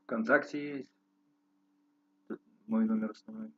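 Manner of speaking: normal talking voice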